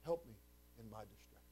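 soft prayer dropping low and very quiet; hushed plea. Acted. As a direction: let the plea sink away softly. Hushed repeat of the cried prayer.

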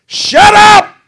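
very high, very loud mock yell; wife's yell. Wife yelling from the bedroom at his shower singing, piercing mock yell. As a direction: holler it like an exasperated wife in another room. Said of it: "my wife yelled from the bedroom".

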